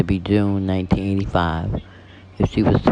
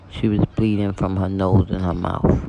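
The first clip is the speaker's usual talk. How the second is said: ordinary delivery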